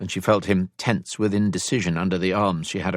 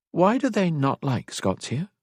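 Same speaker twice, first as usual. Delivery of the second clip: put-on higher-pitched, curious young-lady question; 9 semitones higher than usual. A narrator's reading. Elisand asks why they don't like Scots, curious. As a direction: ask it with real curiosity, pitch lifted. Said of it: "Elisand asked with curiosity".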